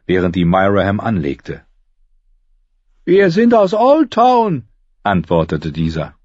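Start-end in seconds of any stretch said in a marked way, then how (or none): 3.1-4.6 high, loud, drawn-out shout; captain calling out. A narrator's reading. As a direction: call slowly across the water from a ship. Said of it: "Sie schrien dem Kapitän ihre Fragen zu".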